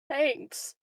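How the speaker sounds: ordinary talk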